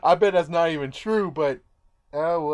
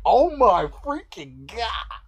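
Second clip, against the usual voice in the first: amazed; 6 semitones higher, 5 dB louder, slower than usual. Real, not acted. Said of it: "reacting to leopard cuddle".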